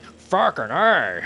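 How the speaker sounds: loud, high, drawn-out mock accent; exaggerated character accent with a big swooping A; imitating a character's accent. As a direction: belt it out slowly in a put-on accent. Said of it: "a character that goes like that".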